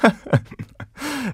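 short, soft high chuckle; real laugh. Real, not acted. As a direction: laugh lightly at your own joke. Laughs at own joke.

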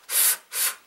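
"f, f" as bare voiceless puffs; blown F sound. Shown as a demonstration. Teacher isolating the F sound, short blown "f". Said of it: "f 자연스럽게 불어주시면".

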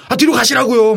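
high-pitched yell of an impatient order; imitated yelling. Crew member ordering an extra back on set, mock yelling. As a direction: yell it high, mimicking someone herding extras. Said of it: "막 저한테 소리 질러요".

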